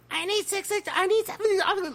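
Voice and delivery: mock the panicking servers in a squeal; hammed-up squealing panicked servers; frantic servers. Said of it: "Like, I was like".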